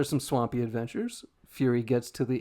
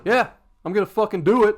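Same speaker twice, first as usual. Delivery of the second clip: defiant; 8 semitones higher, 11 dB louder than usual. Acted. Fury's defiant reply.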